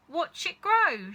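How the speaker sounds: high, loud slow shout. A narrator's reading. Mister Cranky watching the hen, loud slow shout. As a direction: bellow it out eagerly. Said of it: "shouted Mister Cranky".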